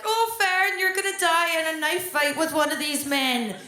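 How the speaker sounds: put-on high, loud fretting boyfriend voice; worried boyfriend. Boyfriend warning Fern about knife fights, high loud fretting. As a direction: play the boyfriend fretting over her. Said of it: "My boyfriend's always like".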